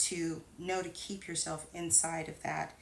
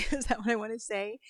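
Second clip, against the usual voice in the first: laughing self-doubt, louder and quicker; laughing at herself; 4 semitones higher, 7 dB louder, faster than usual. Real, not acted. After saying "cut delicately", she laughs at her own phrasing. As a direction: laugh off her own odd word choice.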